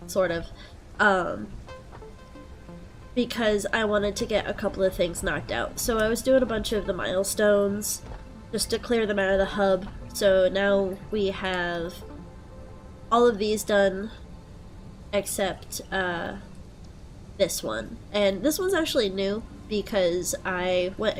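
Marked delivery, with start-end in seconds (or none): none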